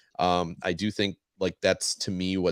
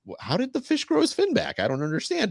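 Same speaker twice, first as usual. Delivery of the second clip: much higher, baffled squeak of a question; mock confused viewer. Confused viewer asking about the fish's fin, high baffled voice. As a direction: play the puzzled viewer, pitch way up. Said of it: "watching the episode itself is like".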